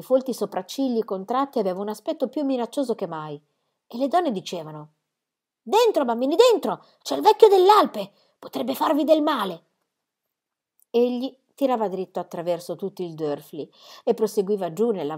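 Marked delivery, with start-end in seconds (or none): 5.6-9.6 put-on high, urgent call to children; alarmed warning. A narrator's reading. Village women calling children away from the old man, alarmed. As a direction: usher them in with a raised, anxious voice.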